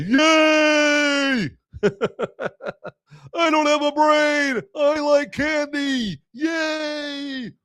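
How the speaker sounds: put-on high, squealing silly kid voice; dumb kid voice. Mocking himself for forgetting a name, squealing like a silly child. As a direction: play a giddy brainless child squealing about candy.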